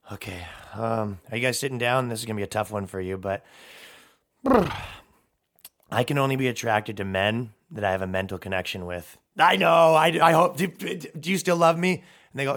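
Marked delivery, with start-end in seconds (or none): 0.1-9.2 mock dramatic confession. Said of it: "you said". 9.4-11.9 louder, pleading, hammed-up; mock pleading daughter. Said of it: "you said".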